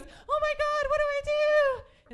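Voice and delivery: high panicked camp shriek; panicked high squeal. Retold or imitated. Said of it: "he goes".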